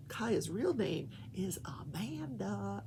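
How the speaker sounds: hushed secret whisper. Real, not acted. Secret aside.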